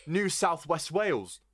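put-on slow, half-hearted Australian accent on New South West Wales; a lightly put-on Australian twang; bad Australian accent. Mocking Australians to a friend, weak Australian accent. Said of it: "I can't do an Australian accent very well".

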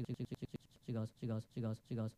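ordinary talk, nothing marked

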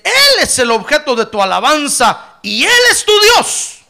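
loud preaching read of scripture; preaching at full force. Acted. Preacher reading Deuteronomy aloud to the church, loud. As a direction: proclaim the verse.

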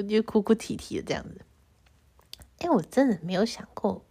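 normal talking voice

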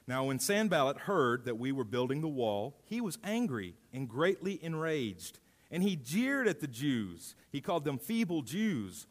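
lifted, measured Bible reading; a public scripture reading voice. Acted. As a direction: read the passage to the church with weight. Reads Nehemiah aloud to congregation.

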